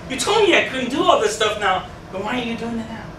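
normal talking voice

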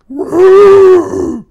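very high, loud beast hoot; hoot-scream like an angry monster; owl bear screech. Acted. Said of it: "this owl bear going".

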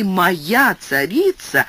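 usual speaking voice

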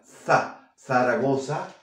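"Za, Zaragoza" with Castilian z, tongue on the teeth; voiceless lisped "Za" then "Zaragoza"; th sound demo. Shown as a demonstration. Teacher linking Zaragoza to threw for learners. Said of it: "¿Ves que es el mismo sonido?".

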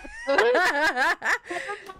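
high wheezing laugh; helpless laughing fit; crying laughter. Real, not acted. Falling in game.

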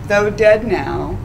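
ordinary talk, nothing marked

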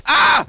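cri aigu et fort de sursaut; un « Ah ! » de frayeur qui part tout seul; cri de surprise. Real, not acted. Surpris par la cigogne. (cri de sursaut quand la cigogne l'attaque)

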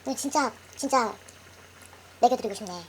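talking around hot food; mouth full of hot food. Real, not acted. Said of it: "뜨거워!".

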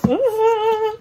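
high, loud delighted ooh; excited squeal. Real, not acted. Thrilled squeal after excitement.

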